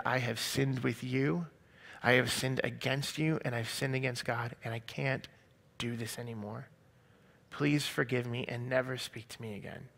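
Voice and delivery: soft, measured admission; subdued, deliberate farewell plea; retold solemn confession. Retold confession and breakup with boyfriend.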